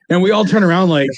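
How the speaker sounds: ordinary talk, nothing marked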